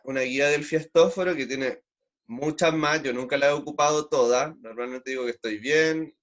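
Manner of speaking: ordinary delivery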